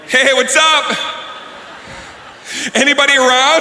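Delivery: sing-out holler; testing yell. Acted. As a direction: call into the house to check it's empty. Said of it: "you have to yell out".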